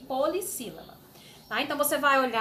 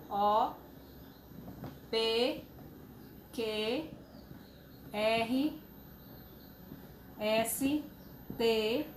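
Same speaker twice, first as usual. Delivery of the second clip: letter by letter, with long pauses; alphabet recital; 2 semitones lower, 7 dB quieter, much slower than usual. Shown as a demonstration. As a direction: recite the alphabet one letter at a time for pupils. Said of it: "nós vamos relembrar".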